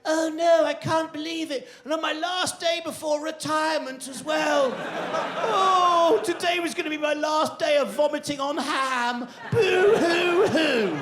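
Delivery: high, loud mock-wailing fly; hammed-up sobbing of a doomed fly. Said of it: "that fly wasn't going".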